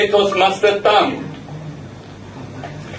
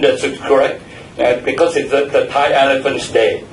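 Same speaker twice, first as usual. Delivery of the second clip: ordinary talk, nothing marked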